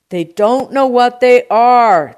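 slow, very loud, high shout. A narrator's reading. Produce man explains to uncle, shouting. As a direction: yell each word out slowly. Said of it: "D'Agostino shouted".

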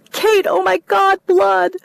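high, loud, panicked sobbing cries; hysterical sobbing. Acted. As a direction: break down in hysterics on the phone. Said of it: "trying to shock her hysterics away".

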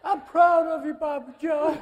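high, loud put-on voice calling out; squealed loud mock tribute; mock sentimental shout. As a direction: ham up a gushing declaration, pitched high. Mocking someone's sentimental cry.

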